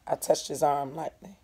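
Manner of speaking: ordinary delivery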